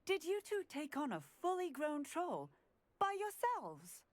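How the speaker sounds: high-pitched disbelief; astonished questioning. Acted. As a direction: ask it amazed, pitch climbing. Officer astonished at students' feat.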